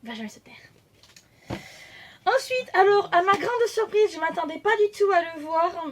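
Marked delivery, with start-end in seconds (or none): 0.0-0.5 low, soft complaint; hushed grumble about a chatty co-host; grumbling aside. Real, not acted. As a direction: mutter it quietly to yourself.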